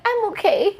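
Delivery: put-on high little-kid voice; imitating a crying kid. Schoolkid telling the reporter he is fine, small high voice. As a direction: squeak it out like a small boy holding back tears. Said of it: "he's like".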